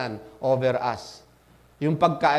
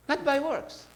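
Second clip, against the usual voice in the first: softer, quieter echo of the point; soft repeat; 9 semitones higher, 5 dB quieter than usual. Real, not acted. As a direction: pull back and say it gently. Preacher repeats the line softly.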